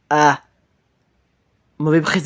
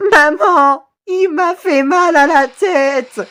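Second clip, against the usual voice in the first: high, drawn-out acted whine of pain; wailing victim; 8 semitones higher, much slower than usual. Victim complaining to mother of hurt head, high drawn-out wail. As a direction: wail it like a terrified crybaby.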